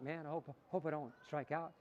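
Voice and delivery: soft, worried hope; quietly wishing not to fail; doubtful mumble. Shown as a demonstration. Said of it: "It's not".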